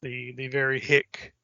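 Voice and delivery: normal talking voice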